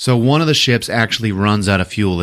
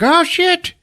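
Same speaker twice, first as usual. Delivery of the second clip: much higher, alarmed squeak; mock startled kid; 3 dB louder than usual. Imitating the stable boy spotting escaped prisoners, startled squeak. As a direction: caught off guard, yelp. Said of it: "And he's like".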